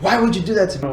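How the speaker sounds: high-pitched acted outburst; mock yelling. Friend confronting the one who wronged him, mock yelling. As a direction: yell it as a hypothetical confrontation. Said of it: "I start yelling at you".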